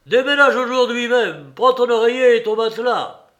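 loud, high order shouted; shouting. A narrator's reading. Father shouting orders to Alyosha, loud. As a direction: bellow it across the distance. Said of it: "cria de loin Fiodor Pavlovitch".

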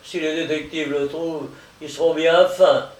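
gloating, mocking exclamation; sneering. Limerick over his victim in the vat, gloating sneer. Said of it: "s'exclama Limerick en ricanant".

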